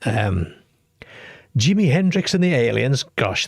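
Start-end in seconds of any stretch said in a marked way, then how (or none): 1.6-3.0 excited. Real, not acted. Reading out a surprising caller topic.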